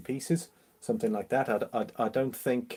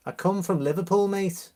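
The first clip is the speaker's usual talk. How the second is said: put-on Scouse, pitched up high; Scouse accent; 8 semitones higher than usual. Shown as a demonstration. Streamer proving he's from Liverpool, Scouse accent. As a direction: lay on the Liverpool accent, higher voice. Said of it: "I don't normally pull the, uh, the Scouse accent out".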